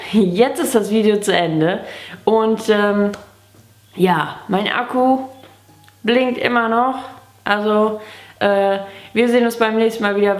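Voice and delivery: ordinary talk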